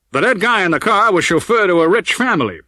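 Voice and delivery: a lightly voiced lawman savouring the news; a cop pleased to spring news on the captain; Olds, pleased with himself. A narrator's reading.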